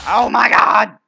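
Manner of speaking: loud, high shocked yell; loud shout. Real, not acted. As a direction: yell it out as you die. Dies in game.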